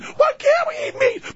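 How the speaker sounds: put-on very high, wailing child; screaming kid. Kid begs parent for McDonald's, screaming. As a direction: shriek a child's demand. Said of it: "their eight screaming kids".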